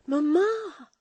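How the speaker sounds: high, loud frightened call of Mamma; frightened cry. A narrator's reading. Florence crying out to her stepmother in fright. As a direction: cry out in alarm for her mother. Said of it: "she cried".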